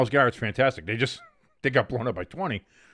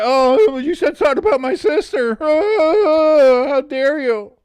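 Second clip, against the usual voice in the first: high, louder mock-offended outrage; mocking hamster; 8 dB louder, slower than usual. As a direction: ham up his indignation. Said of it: "Same thing as last week".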